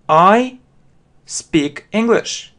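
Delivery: I said high and loud, then a slow speak English; teacher modelling I speak English, drawn out; slow model sentence. Shown as a demonstration. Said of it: "Вы можете повторить".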